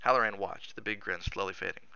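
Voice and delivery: usual speaking voice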